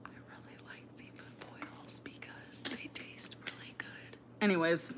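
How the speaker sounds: soft close whisper; breathy whisper, barely voiced. Real, not acted. Whispered eating chat.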